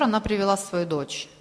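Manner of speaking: usual speaking voice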